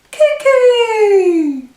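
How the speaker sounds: very high, loud cuckoo shout; cuckoo call. Acted. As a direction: call out like the clock's bird popping out. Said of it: "our tick-tock song".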